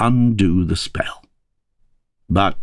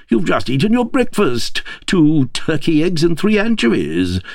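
high-pitched royal retort; sharp retort; 9 semitones higher than usual. A narrator's reading. King answers the crying Queen about breakfast, retorting. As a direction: throw it back, pitched high. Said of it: "retorted the King".

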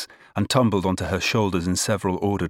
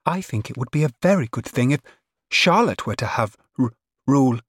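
higher, deliberate pronouncement with a catch; pronounced with stammer; 5 semitones higher than usual. A narrator's reading. Youngest sister proposes another bride, pronouncing with a stammer. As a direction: pronounce it firmly, stumbling on the R. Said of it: "she pronounced".

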